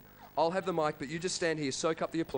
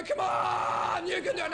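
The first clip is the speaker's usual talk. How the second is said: crowd urging; 10 semitones higher, slower than usual. Retold or imitated. Crowd urging the collapsing singer on, mock shout. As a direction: cheer him on as the crowd. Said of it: "The crowd go".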